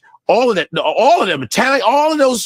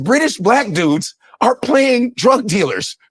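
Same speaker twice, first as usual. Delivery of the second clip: usual speaking voice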